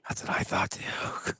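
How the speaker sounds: slow, hushed agreement; conspiratorial whisper. Real, not acted. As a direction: whisper it like a shared secret. Conspiratorial agreement about Lori's fail.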